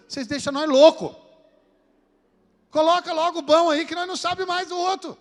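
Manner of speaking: loud, high-pitched comic outburst; exasperated complaint. Retold or imitated. Patient fed up with the optometrist's questions, exasperated shouting. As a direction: plead loudly, at the end of your patience.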